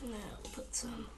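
whisper. Real, not acted. Whispered aside.

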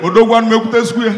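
loud quick swagger call to a friend; hammed-up market man boast. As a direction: brag to the friend, laughing. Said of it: "turn look in friend".

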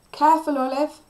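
high, loud warning; cried. A narrator's reading. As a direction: call out a warning. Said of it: "Cliff cried".

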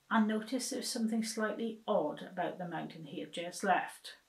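usual speaking voice

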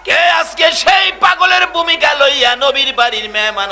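high, shouted challenge to the crowd; full-force preaching. Acted. Preacher asking who is the Prophet's devotee today, full-force preaching. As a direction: cry it out with long stretched endings.

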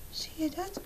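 hushed little question; whispered aside. A narrator's reading. Storyteller whispering to the child about the picture. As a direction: whisper it while pointing at the picture.

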